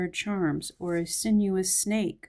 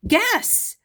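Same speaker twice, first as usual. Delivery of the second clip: excited shout of the brand; 7 dB louder than usual. Real, not acted. Excited about Guess perfume.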